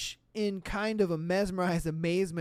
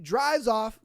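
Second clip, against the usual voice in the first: ordinary delivery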